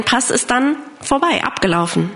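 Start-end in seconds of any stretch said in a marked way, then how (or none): none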